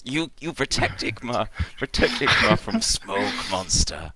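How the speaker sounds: high, loud plea for protection; frightened clinging character. Acted. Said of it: "leaps up onto his back and goes".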